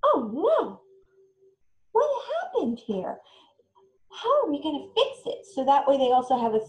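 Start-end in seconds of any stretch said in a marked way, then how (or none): 0.0-5.4 gentle oh no. Shown as a demonstration. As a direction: act gently surprised at the mistake, then offer help. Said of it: "to go".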